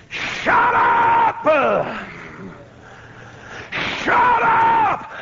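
very high, strained yell; furious shout. Retold or imitated. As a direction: scream the crowd into silence. Silencing the wild screaming crowd.

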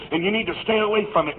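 ordinary delivery

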